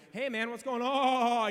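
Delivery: captain calling over to the downed player; slow loud captain asking what's wrong; concerned captain. Retold or imitated. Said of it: "he's like".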